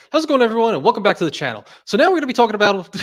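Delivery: high, loud, fast YouTuber intro; JP Knowledge impression. Streamer imitates JP Knowledge opening videos, high, loud, fast. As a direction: launch in like a rocket. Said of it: "he starts going".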